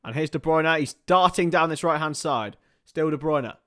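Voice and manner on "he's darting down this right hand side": ordinary talk, nothing marked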